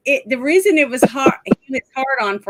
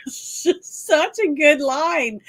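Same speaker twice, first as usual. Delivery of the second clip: slow, higher, breaking up with laughter; laughing; 4 semitones higher, slower than usual. Real, not acted. Fan recalling a favourite movie line, laughing. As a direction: squeeze the words out through laughter.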